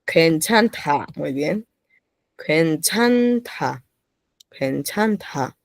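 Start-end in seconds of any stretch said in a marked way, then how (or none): 0.0-1.1 slow model reading. Shown as a demonstration. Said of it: "¿Cómo suena la palabra?". 2.4-5.6 slow model reading. Shown as a demonstration. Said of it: "¿Cómo suena la palabra?".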